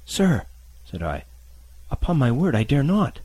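put-on high, scared boy's voice; frightened boy.

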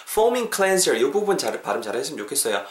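ordinary talk, nothing marked

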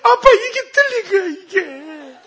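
high, loud mock wail; drawn-out, hammed-up tearful whine; imitated crying child. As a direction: wail to dad like a bawling kid. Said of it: "막 울더니".

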